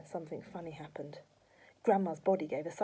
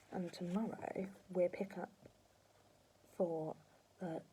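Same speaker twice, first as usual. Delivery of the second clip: whispered; 8 dB quieter, slower than usual. Real, not acted. Reader signs off.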